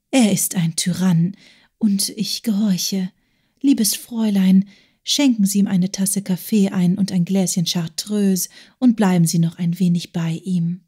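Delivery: resigned hushed whisper; quiet breathy resignation. Acted. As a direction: whisper it, giving in sadly. Agathe resigned aside to Lotti.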